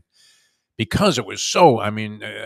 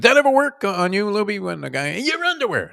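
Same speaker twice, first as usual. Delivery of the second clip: much higher, amused pitch; pitched way up for a silly taunt; high mock heckle; 11 semitones higher than usual. Co-host ribbing Luby about heckles, high teasing voice.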